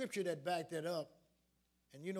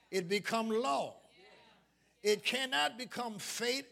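slow, raised sermon cadence with stretched words; forceful preaching; 4 semitones higher, 7 dB louder than usual. Real, not acted. Preacher warning church against strange doctrine, forceful preaching. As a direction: drive each contrast home to the congregation.

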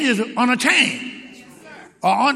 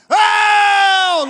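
high, loud shouted oh; preacher's loud cry; 9 semitones higher, 6 dB louder than usual. Shown as a demonstration. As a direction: cry out to get the church going. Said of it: "make a loud voice".

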